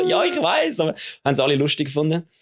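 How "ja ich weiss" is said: squeaky embarrassed. Retold or imitated. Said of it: "Ich so".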